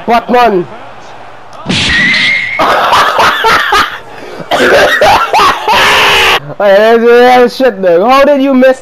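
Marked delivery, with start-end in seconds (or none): 1.6-6.5 long, high, breathy laughing shout; wild laugh. Real, not acted. Gamer laughing after the opponent misses, wild laugh. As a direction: burst out laughing in relief.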